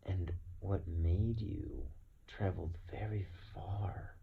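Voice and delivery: ordinary delivery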